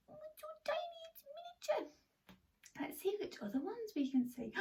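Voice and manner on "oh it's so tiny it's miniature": very high, soft tiny voice; tiny squeak. Pretend. Teacher acting tiny for children, high soft squeak. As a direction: shrink your voice to a squeak.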